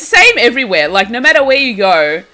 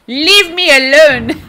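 laughing protest; 6 semitones higher, 5 dB louder, slower than usual. Real, not acted. Friend teasing her.

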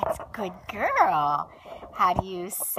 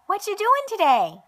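put-on high, quick baby voice for the child; voicing the baby; 13 semitones higher, 3 dB louder than usual. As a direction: speak for the little one, high and fast.

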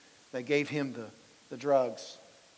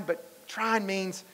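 ordinary talk, nothing marked

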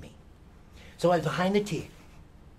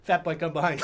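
ordinary delivery

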